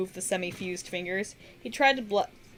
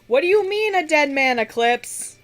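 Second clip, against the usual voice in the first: shouting; 8 semitones higher, 9 dB louder than usual. Acted. Demon shouts chasing her in storm.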